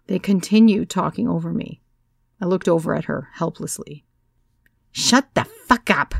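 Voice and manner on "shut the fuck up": slow, a touch of blunt command; hint of the pregnant woman's order; lightly voiced order. A narrator's reading. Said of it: "came the order from the yellow lady".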